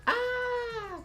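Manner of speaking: very high, sustained horror-movie scream; imitated scream. Shown as a demonstration. Host imitating a horror movie scream, high and held. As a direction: let out a long piercing mock scream. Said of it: "Or a scream".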